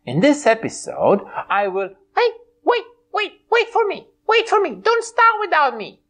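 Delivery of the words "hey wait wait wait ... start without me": squeaky high sidekick calling out; a high little puppet voice hurrying in. Acted. Puppet friend running late, high squeaky voice.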